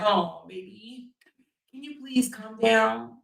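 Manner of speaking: soft, slow, coaxing plea; mock gentle. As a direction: act out an ever-so-gentle lover, hushed. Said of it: "ever so gently be like".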